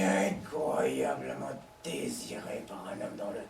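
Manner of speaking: hushed, breathy-free whisper, voice dropped away; whispered confession. Acted. To her lover on the phone, an intimate whisper. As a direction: whisper it close to the mouthpiece.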